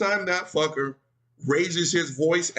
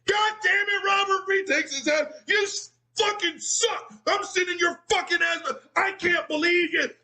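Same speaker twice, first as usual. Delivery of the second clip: shrill, much higher furious coach yell; 12 semitones higher than usual. Retold or imitated. Coach blowing up at a player at practice, furious yelling. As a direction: explode at him like a raging coach. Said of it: "Then he gets to me".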